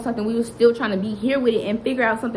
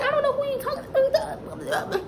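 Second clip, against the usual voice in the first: much higher, slow, tearful retort; high, slow, tearful I-don't-know-who-you-talking-to; upset comeback; 5 dB quieter than usual. Retold or imitated. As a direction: fire it back near tears, pitched way up. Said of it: "I'm going in".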